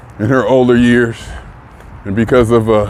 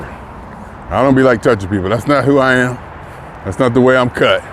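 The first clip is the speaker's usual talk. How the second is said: normal talking voice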